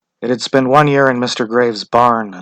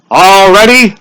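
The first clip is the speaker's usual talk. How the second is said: louder, higher call; 10 semitones higher, 12 dB louder, slower than usual. A narrator's reading. Official calls the crowd to order, loud. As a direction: call across the square. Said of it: "he called".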